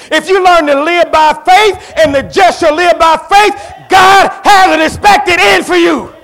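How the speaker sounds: loud, high preaching ending in a slow shout; preaching at full force. Acted. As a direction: lift the church to its peak. Climax of sermon, shouting.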